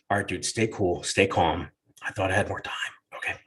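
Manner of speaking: low, soft pep talk to himself; a dad steadying himself before the talk, quietly; nervous self-talk. Retold or imitated. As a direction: mutter to yourself to stay calm. Panicked self-talk under breath.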